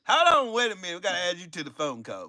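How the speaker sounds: ordinary talk, nothing marked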